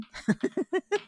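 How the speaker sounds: high-pitched giggle; short self-mocking giggle; amused laugh. Real, not acted. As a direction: laugh at your own silly attempt. Laughs at own failed accent attempt.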